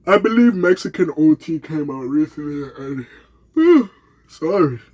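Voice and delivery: talking through a yawn. Real, not acted. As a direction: yawn mid-word, then apologize. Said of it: "I'm yawning like a motherfucker".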